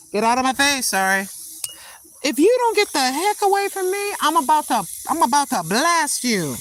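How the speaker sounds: loud, high, furious yelling to shoo it off; angry shout at a bug. Real, not acted. Granny yelling at a bug buzzing her face, furious shout. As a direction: snap at the pest, high and loud.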